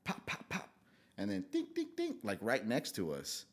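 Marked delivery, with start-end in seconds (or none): 0.1-0.7 soft, unvoiced popping; imitated gunshots. Telling friend what they heard at the party, imitated gunshots. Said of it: "we hear". 1.5-2.1 high ringing dings; imitated bullet pings. Bullets hitting the pole next to them, imitated pings. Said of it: "the bullets hit the pole".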